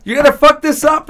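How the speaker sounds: high, loud exasperated yell at Walter; hammed-up furious shout; mock angry yell. Dude yelling at Walter in the movie, mock angry yell.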